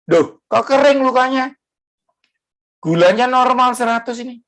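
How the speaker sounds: high-pitched astonishment, acted out; startled doctor. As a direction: imitate a doctor amazed at the results. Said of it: "dokternya kaget".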